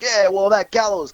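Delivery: high fake-Italian wiseguy voice; Fat Shitso impression. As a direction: imitate the fake Italian guy explaining himself. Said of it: "fake Italian guy".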